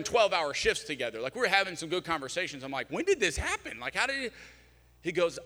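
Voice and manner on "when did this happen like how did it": retold surprise. Retold reaction to friend's news.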